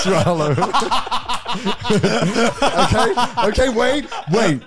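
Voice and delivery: high, helpless laughter breaking through words; cracking up while trying to go on; real laughing fit. Real, not acted. Laughing too hard to tell the Barry Chopsticks story.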